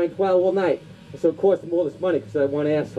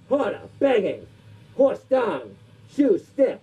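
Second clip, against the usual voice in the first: hammed-up dubbed kung fu film voice, higher; kung fu movie villain; 4 semitones higher than usual. Comic sketch parodying a dubbed kung fu film, hammed-up villain voice. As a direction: ham it up like a badly dubbed martial arts movie.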